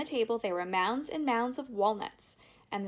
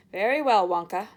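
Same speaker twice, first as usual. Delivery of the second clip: important; 11 dB louder than usual. Acted. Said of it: "he said importantly".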